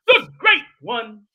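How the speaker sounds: big, loud hype-man shout; loud, stretched-out showman introduction; bringing the energy. Pretend. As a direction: belt out GREAT one, high and slow. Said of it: "trying to bring the energy".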